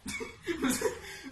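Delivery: breathy laugh. Real, not acted. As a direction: burst of laughter. Laughing at the sour lemon. (ضحك)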